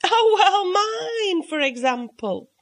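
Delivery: silly guest answering the cat with a nervous laugh; little laugh. A narrator's reading. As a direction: laugh it off, embarrassed. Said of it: "with a little laugh".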